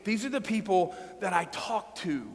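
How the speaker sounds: ordinary talk, nothing marked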